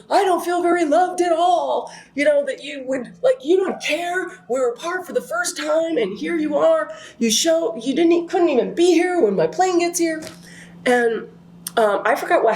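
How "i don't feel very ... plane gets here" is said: high, pitched-up hurt complaint kept up all through. Retold or imitated. Scolding her fiance for being late, high wounded complaint. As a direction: whine out the grievance way up high, wounded.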